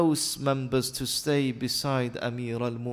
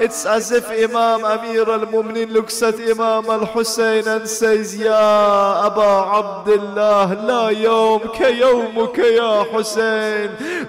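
very loud, high, slow wailing oratory; preacher lamenting at full force; impassioned lament sermon; 11 semitones higher, 15 dB louder than usual. Real, not acted. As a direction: stretch each phrase into a grieving cry.

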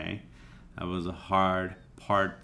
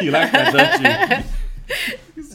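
much higher and louder teasing; 13 dB louder, slower than usual. Real, not acted. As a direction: playfully needle your partner. Teasing partner who laughed.

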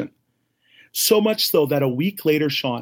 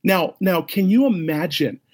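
ordinary delivery

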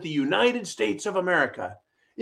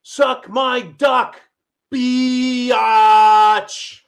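slow, loud, higher put-on shriek of an activist; mock screaming activist; 6 semitones higher, 10 dB louder, much slower than usual. Host mimicking a protester yelling at pro-lifers, hammed-up shout. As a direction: ham up a crude yell. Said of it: "screamed at pro-lifers".

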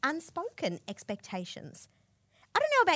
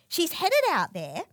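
ordinary talk

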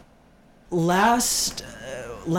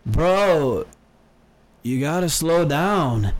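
frat bro; much slower than usual. Retold or imitated. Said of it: "this is what he says".

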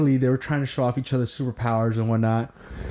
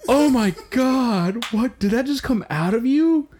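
high, drawn-out mock amazement; astonished; 11 semitones higher than usual. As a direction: retell it as a high, incredulous gasp of disbelief. Retelling astonished Spider-Men's reaction.

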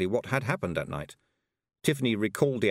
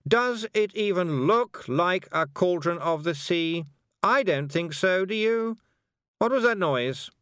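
high, louder nagging know-it-all; bossy Anagramma; 10 semitones higher, 4 dB louder than usual. A narrator's reading. Anagramma bossing the girls around the circle, high and nagging. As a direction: order everyone about with weary scorn.

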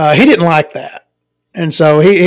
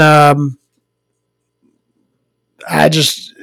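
ordinary talk

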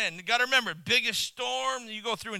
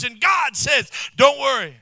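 preaching at full force; 2 semitones higher, 11 dB louder than usual. Acted. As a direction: preach it with fire. Preaching climax.

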